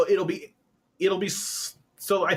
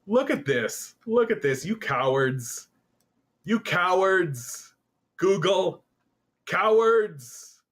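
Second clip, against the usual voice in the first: higher, stretched-out scolding; indignant jeering; 6 semitones higher, slower than usual. Real, not acted. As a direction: call them out with mock outrage, dragging the insult. Jeering at Google on stream.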